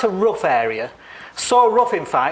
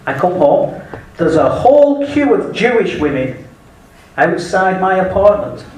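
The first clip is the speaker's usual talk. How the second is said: ordinary delivery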